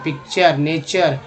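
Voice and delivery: normal talking voice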